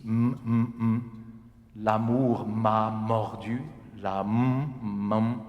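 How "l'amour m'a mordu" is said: "l'amour m'a mordu" slow, leaning on each M; stressed M sounds. Shown as a demonstration. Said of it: "all these D, M".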